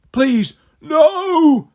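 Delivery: high, loud pleading wail; pitiful moan. A narrator's reading. Beaten Cyclops begging Percy for mercy, pitiful. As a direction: beg for your life. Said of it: "the Cyclops moaned, pitifully".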